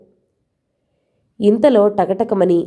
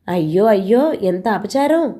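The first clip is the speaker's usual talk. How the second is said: ordinary delivery, nothing marked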